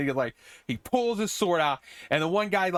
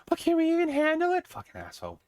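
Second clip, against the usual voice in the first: mocking the girl; 14 semitones higher than usual. Narrator mocks a girl's needless doubt, high and whiny. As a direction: mimic her in a silly high voice. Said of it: "one girl's like".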